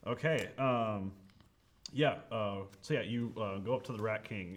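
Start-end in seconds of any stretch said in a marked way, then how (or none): none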